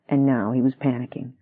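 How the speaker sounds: usual speaking voice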